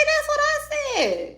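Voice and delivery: slow high falsetto, way above her normal voice; squeaky high voice. Pretend. Vlogger agreeing with herself, in a slow squeaky falsetto. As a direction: say it like a cartoon squeak, stretched out. High echo then plain repeat.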